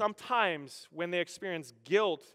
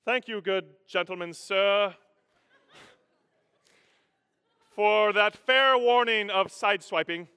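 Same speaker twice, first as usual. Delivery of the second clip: raised, loud, drawn-out formal thanks; mock-polite gentleman; 5 semitones higher, 8 dB louder than usual. Driver thanking the one who honked, mock-formal and polite. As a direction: proclaim overdone courteous gratitude. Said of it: "rather than responding".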